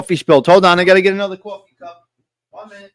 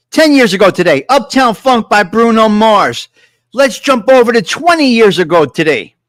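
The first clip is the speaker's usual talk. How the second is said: loud DJ countdown announcement; radio DJ hyping the throwback song; radio host; 3 semitones higher, 6 dB louder than usual. Acted.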